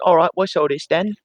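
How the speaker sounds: put-on chirpy London paper boy accent; British paper boy voice. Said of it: "[name] is doing an impression of a British paper boy".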